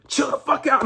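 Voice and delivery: high-pitched mock outburst; squeaky-high imitation of an exasperated cop; mock scolding. Quoting the movie's bad cop.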